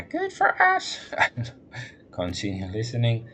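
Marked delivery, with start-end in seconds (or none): 0.0-1.0 very high, thin man's voice; high-pitched man voice. Acted. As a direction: squeak it way up high. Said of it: "a man who speaks in a high-pitched, breathy tone".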